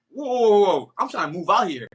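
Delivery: loud, high protesting whoa. Retold or imitated. Comic pushing back on the director, loud protest. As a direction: stop him in his tracks. Protesting being sent home.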